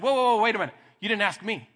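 high, loud "whoa, whoa" cutting in; man skipped over calls out to his friend; indignant protest. Retold or imitated. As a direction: stop him mid-flow, half laughing.

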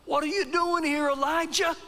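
high, insistent call to Elijah; pressing voice asking Elijah what he's doing. Acted. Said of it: "It's still in a still small voice".

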